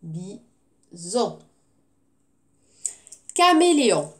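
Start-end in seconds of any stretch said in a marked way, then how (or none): 0.0-1.4 Bison split in two, BI, then ZON; syllable by syllable. Shown as a demonstration. Teacher counting two syllables for pupils, Bison split syllable by syllable. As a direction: say Bison softly with a clear gap between syllables. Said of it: "Deux syllabes".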